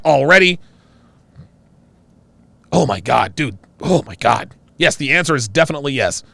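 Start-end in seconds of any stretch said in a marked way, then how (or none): none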